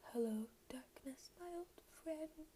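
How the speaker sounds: hushed, half-sung gloomy lyric; mock gloomy whisper. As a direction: breathe the line like a sad song. Quoting a gloomy song line.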